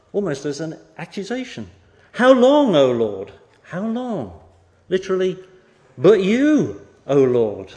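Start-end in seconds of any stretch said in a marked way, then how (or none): 2.1-4.4 loud, high cry of How long; anguished exclamation. Acted. David crying out loud to a silent God. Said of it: "You can almost hear the exclamation marks". 6.0-7.7 loud accusing cry to the Lord; exclamation. Acted. David crying out to God, loud and accusing. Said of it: "You can almost hear the exclamation marks".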